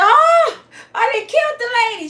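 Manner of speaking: high, loud mock panic; hammed-up panicked shriek; panicked cry. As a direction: wail it in comic horror. Acts out panic after hitting her.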